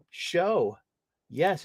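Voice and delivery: ordinary talk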